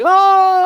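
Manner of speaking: high, loud mock scream of pain; burning man's scream. German man with his legs on fire, high hammed-up scream. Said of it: "His legs are kind of, like, on fire".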